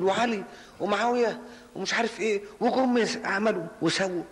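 mocking shrill quote. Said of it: "ما صحت بتقول".

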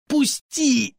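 a pained cry of protest; yelping to be let go; howled. A narrator's reading. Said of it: "взвыл Тёмный".

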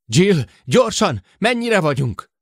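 high, loud call for help; urgent shout. A narrator's reading. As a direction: shout an urgent question over the radio. Urgent radio call for the distance. (sürgető, hangos kiáltás)

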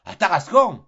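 loud, high outcry; exclaimed. Acted. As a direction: burst out as it dawns on him. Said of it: "s'écria Tartarin, subitement illuminé".

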